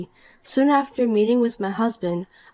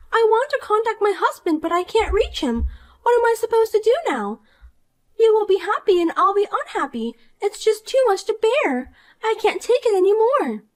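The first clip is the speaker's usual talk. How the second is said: put-on higher, whiny young woman, hurt; Poppy, upset; 12 semitones higher than usual. Rejected Poppy whines to the wife, higher and wounded. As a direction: complain like a spoiled brat who got rejected.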